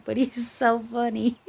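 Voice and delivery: ordinary talk, nothing marked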